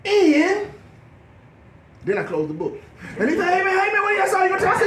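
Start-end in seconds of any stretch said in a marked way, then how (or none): 0.1-0.7 loud, high drawn-out preacher opening. Acted. Man reading Genesis aloud, in his best preacher voice. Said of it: "read my best preacher voice". 3.5-4.8 high-pitched baffled plea; urgent pleading. Retold or imitated. Man asking for the rest of his word, high urgent plea. Retold man begging for more.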